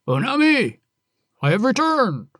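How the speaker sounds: husband shouting his arrival home; calling out. Acted. Husband calling to his wife Onami as he returns, loud.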